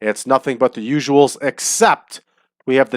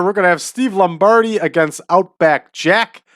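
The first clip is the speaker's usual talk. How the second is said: ordinary delivery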